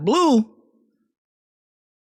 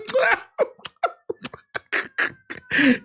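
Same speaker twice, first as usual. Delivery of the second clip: high-pitched burst of laughter; 3 dB quieter than usual. Real, not acted.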